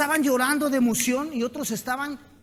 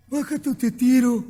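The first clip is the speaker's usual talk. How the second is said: elephant voice; 3 semitones higher, 7 dB louder than usual. Acted. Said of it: "le dice el elefante".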